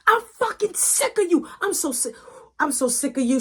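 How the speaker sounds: high furious yell at her son; angry yelling mom. Retold or imitated. Mom screaming at her son, high and furious. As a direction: spit it out, fed up and furious.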